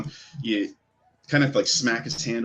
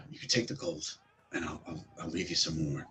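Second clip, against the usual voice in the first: low, very soft plea; hushed, halting offer; timid bargaining; 3 semitones lower, 11 dB quieter than usual. Acted. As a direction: bargain meekly to be let go. Cornered elf bargains for release.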